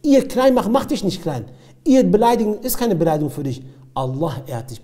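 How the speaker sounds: ordinary talk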